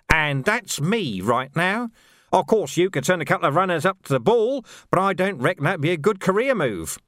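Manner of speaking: menacing Carcer with a sly, amused edge; sneering sergeant. Acted. Carcer taunting the Major about the burned watch house, sly. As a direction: needle the officers with mock concern.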